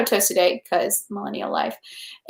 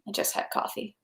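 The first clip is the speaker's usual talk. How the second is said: whisper; 14 dB quieter than usual. Real, not acted. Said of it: "confessing caffeine in whispered aside".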